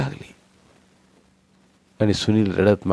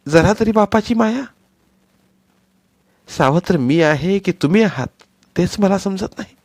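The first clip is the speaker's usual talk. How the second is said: bitter rebuke; 9 semitones higher, 5 dB louder than usual. Acted. Wife rebukes husband.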